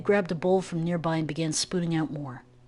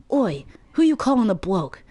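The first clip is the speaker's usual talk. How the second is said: loud, sharp, higher angry retort; angry bark; 5 semitones higher, 6 dB louder than usual. Acted. Kid barks angrily at the hidden voice. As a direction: bark it back in anger at the stranger. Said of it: "Kid barked angrily".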